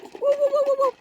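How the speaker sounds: high, loud whooping imitation of a noise; imitated whoo sound. Copying the noise she hears, loud high whoops.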